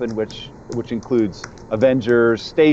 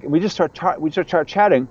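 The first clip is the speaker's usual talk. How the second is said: normal talking voice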